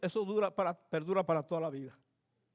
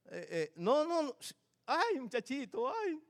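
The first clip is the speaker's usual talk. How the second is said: mock scolding; 6 semitones higher, 5 dB quieter than usual. Imita regaño al nieto.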